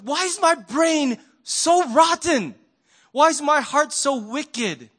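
exasperated at own heart, slowly; self-reproach. Real, not acted. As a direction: berate yourself with dismay. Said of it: "self-reproach".

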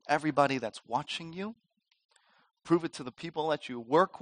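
soft, measured goading as Satan; quiet taunting challenge. Acted. Satan goading us to prove ourselves, soft taunting challenge. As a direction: lay down the dare softly, pausing between phrases. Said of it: "Satan does the same thing to us".

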